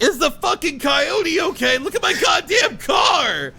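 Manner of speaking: raging high-pitched shout; furious yell. Retold or imitated. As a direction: yell at your friend for caring about the coyote. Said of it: "Like, I was so mad".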